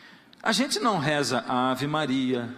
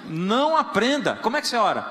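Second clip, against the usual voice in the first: raised mock insistence; mock urging; 5 semitones higher, 4 dB louder than usual. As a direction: press the newcomer eagerly. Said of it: "a vontade é dizer assim".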